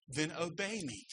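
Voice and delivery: softly spoken, firm instruction; quiet parental command. Retold or imitated. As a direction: give the order softly, as a parent would. Parent instructing child, retold.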